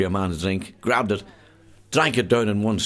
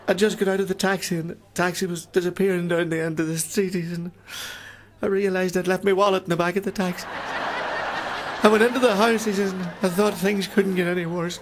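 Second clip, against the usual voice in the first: miserable drinker's lament; 10 semitones higher than usual. Acted. Sad man telling the truck driver his woes: high mournful lament. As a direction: moan every misfortune, barely holding back laughter.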